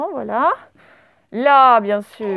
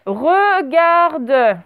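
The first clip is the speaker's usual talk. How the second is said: drawn-out "Regarde", syllable by syllable; dictation; 3 semitones higher than usual. Shown as a demonstration. Teacher dictates a word to the class, drawn-out dictation. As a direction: dictate "Regarde" slowly, each syllable distinct.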